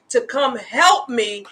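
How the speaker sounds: ordinary delivery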